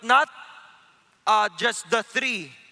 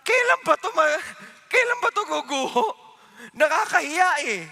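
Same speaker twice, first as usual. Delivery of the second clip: higher, louder exasperated complaint; impatient grumble; 5 semitones higher, 3 dB louder than usual. Pretend. Israelites complaining about the endless marching, exasperated. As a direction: whine like tired marchers fed up.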